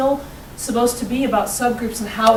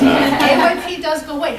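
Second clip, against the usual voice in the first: laughing; 6 semitones higher, 7 dB louder than usual. Real, not acted. Relief that AYP disappears.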